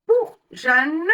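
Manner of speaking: ordinary talk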